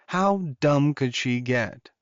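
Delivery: plain reading voice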